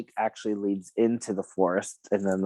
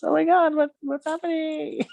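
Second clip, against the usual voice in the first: mock panic, pitched far higher; alarmed wife. Wife panicking in front of the kids, high mock alarm. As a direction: squeal it up high like a startled wife. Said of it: "And so she's like".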